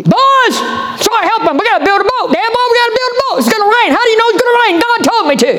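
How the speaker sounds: put-on rally the boys with a shout; Noah calling his sons. Noah urging his sons to build the ark, shouting.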